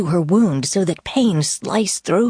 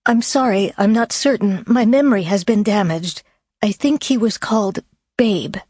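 anxious woman voice; 5 semitones higher than usual. Acted. Claire answers anxiously.

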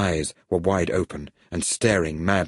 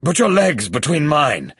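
raised, high-pitched urgent order; urgent shout; 7 semitones higher, 4 dB louder than usual. A narrator's reading. Hero instructs companion in the sea, urgent shout. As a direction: call it out over rushing water. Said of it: "shouted Bond".

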